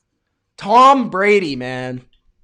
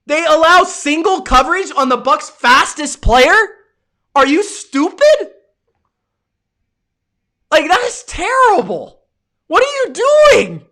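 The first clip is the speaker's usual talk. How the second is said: rising high to an incredulous shout; exasperated rant; 6 semitones higher than usual. Real, not acted. Streamer berating the Packers coaching, exasperated rant. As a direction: demand answers from the screen.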